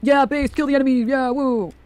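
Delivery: high-pitched mocking cheer; hammed-up bloodthirsty hurrah. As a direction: parody a gung-ho supporter whooping. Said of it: "be like".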